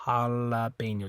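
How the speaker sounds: "Jalapeño" with a normal English L; Spanish jalapeño. Shown as a demonstration. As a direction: say "Jalapeño" with a clear L. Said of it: "an English L sound".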